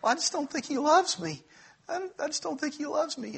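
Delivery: high, tearful whine, imitating a crying wife; hammed-up sobbing plea; mock sobbing wife. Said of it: "she breaks down in tears and she's, like, sobbing".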